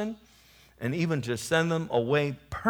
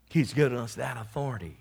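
usual speaking voice

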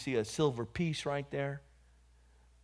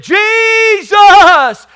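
high, very loud drawn-out shout; full-volume yell; 23 dB louder than usual. Retold or imitated. Cornered man calling on Jesus, full-volume yell. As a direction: cry out the name with all your force. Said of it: "as loud as I could".

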